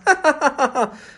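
high, loud acted laugh; short string of high ha-has; fox's boastful laugh. A narrator's reading. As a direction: laugh cockily in a high fox voice. Fox laughs after boasting to crow.

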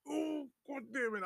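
high, held-back cursing; stifled pain. Retold or imitated. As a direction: act out a squeezed cry of pain. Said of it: "I'm holding my".

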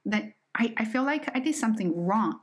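usual speaking voice

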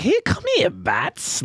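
a Joker-like imp calling out; high-pitched impish leprechaun call. Retold or imitated. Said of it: "a Mark Hamill Jokerish voice".

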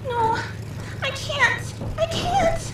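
very high, desperate no; terrified refusal. Acted. Woman refusing to jump from the plane, terrified. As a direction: plead in rising terror.

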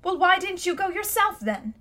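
put-on young Diggory pushing back, sharper pitch; a boy daring his uncle; boy's challenge. Boy voiced in a story.

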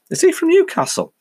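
high, squeaky mock listener query; mock listener asking. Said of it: "you will go".

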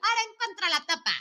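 high, quick playful challenge; mock game-show dare; joking tease. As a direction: toss out a teasing challenge. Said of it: "ahorita ya lo tomo a goza".

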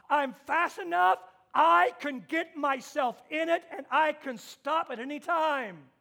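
loud, driving sermon voice; preaching at full force. Acted. Pastor warning about temptation, full force. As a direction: push the warning hard at the congregation.

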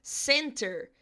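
'center' said with an American r-coloured ending; American English word. Shown as a demonstration. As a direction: model 'center' clearly, sounding the final r. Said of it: "Por exemplo".

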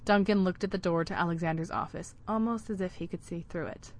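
ordinary delivery

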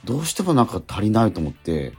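ordinary talk

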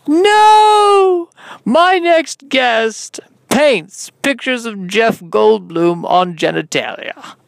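long, high, loud cry easing into slow announcement; hammed-up host, stretching every phrase; over-the-top show host. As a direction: announce it grandly, very drawn out. Plays a talk-show host.

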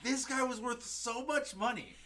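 high, loud astonished insistence; mind-blown. Real, not acted. Host telling listeners the date was worth millions, astounded. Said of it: "it was blowing my mind".

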